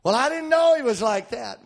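high, whiny protest of regret; mock dismayed complaint. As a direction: imitate a disillusioned sweetheart. Voices regretful partner after breakup.

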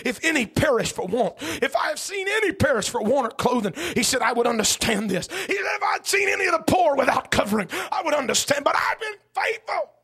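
rising sermon shout. Acted. Preacher as Job swearing he has been faithful, rising sermon shout. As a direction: build to a pleading cry of innocence.

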